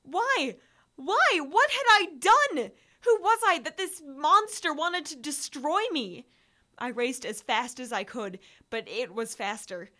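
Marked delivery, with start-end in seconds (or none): 0.0-6.3 high, loud pleading questions; desperate panic. A narrator's reading. Hunted narrator asking why the monster wants him, high desperate panic. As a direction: cry out why in terror.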